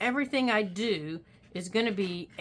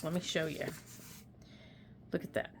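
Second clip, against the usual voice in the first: usual speaking voice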